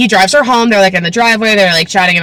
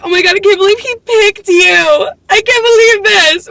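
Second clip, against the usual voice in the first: high-pitched, hammed-up preteen outrage; mock preteen meltdown in a high voice; twelve-year-old girl; 11 semitones higher than usual. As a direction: imitate a scandalized young girl, way up high. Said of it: "being a twelve-year-old girl".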